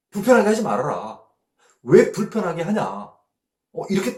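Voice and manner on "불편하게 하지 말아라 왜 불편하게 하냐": disciples blocking. Acted. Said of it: "제자들이 딱 옆에서 차단하는 거죠".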